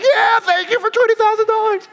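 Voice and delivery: very high celebratory squeal; hammed-up shriek of joy, loud and high; over-the-top excitement. Man imagining himself fooled on camera, hammed-up ecstatic squeal. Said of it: "at least get excited enough".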